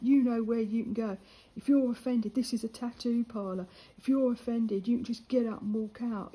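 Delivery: loud, blunt telling off; firm telling off. Retold or imitated. Telling the lad to leave the tattoo parlour, loud and stern. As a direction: shut him down, loud and stern.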